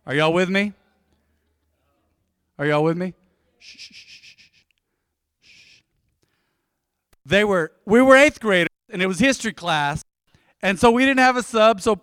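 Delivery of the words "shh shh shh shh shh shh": soft unvoiced shh to hush the room; quiet shushing sounds. Real, not acted. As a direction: hush everyone down. Hushing the congregation mid-story.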